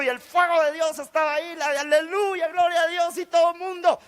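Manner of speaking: high, excited retelling of praise; fervent praise. Retold or imitated. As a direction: get carried away recreating church fervor. Recreates fervent praise at retreat.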